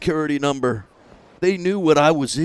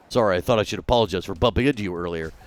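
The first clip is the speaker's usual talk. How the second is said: low, soft, fast apology; timid polite mumble; meek apology; 7 semitones lower, 3 dB quieter, faster than usual. Pretend. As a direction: rush it out meekly.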